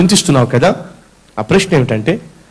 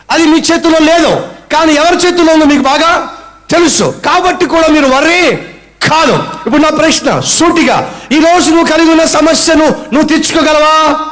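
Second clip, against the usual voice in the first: raised, driving sermon voice; fervent preaching; 6 semitones higher than usual. Acted. Preacher urges congregation to trust God, fervent preaching. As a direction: press the question home to the crowd. Preacher builds to full force.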